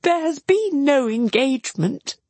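high, emphatic protest; acted distressed correction; crying out in denial. A narrator's reading. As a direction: deny it, pitched well up. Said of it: "cried Marianne".

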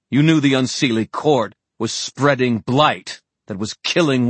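high, forceful indictment; Julian, indignant. Acted. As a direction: hurl the charges indignantly. Story figure accuses.

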